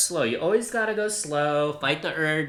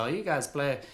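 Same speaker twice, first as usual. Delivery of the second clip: bad Arkansas accent; 2 semitones lower than usual. Quoting his uncle Jack teasing about Jersey blues, in a bad Arkansas accent. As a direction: lightly mimic an Arkansas uncle, clearly not nailing the accent. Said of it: "That was a horrible Arkansas accent".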